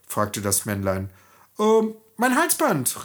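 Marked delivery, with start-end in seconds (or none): 1.6-3.0 put-on girl calling out. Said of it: "rief das Mädchen".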